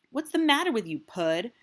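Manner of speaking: put-on a kid calling out in class. A narrator's reading. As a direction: call out to him from a few desks away. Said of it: "Dylan calls out".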